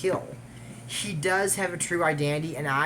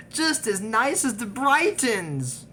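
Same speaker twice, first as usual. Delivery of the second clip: sarcastic; 8 semitones higher, 3 dB louder, much slower than usual. Real, not acted. Doubting the new family.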